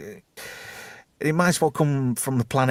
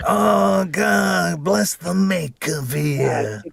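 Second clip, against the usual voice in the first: Londo voice; 6 semitones higher, slower than usual. Retold or imitated. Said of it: "I was trying to do Londo".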